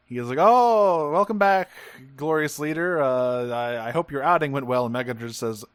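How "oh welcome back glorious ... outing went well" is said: high, loud fawning welcome that settles down; mock sycophantic. Lackey greets the returning leader, hammed-up sycophant. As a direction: gush like a toady greeting the boss. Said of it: "goes into fucking sycophant mode".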